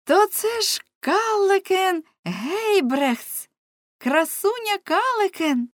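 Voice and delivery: high, drawn-out cry of recognition; exclaiming. A narrator's reading. Nele recognizing beautiful Calleken, exclaiming. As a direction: call it out high, slow and delighted. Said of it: "Нелі вигукнула".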